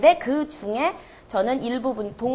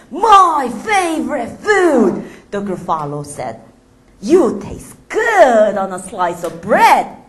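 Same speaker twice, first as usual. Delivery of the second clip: slow, loud monster voice; hungry Gruffalo; 7 dB louder, much slower than usual. Acted. The Gruffalo threatening to eat the mouse, hungry. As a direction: growl it out slowly.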